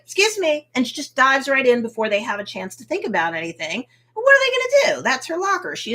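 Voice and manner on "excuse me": high, bright, assured excuse me; mock confident excuse me. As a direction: breeze past with an excuse me. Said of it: "just like".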